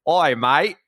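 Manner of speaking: high, loud hammed-up Australian accent greeting; mock Australian accent. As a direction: imitate a bad Australian dub voice. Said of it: "the Americans were clearly Australian".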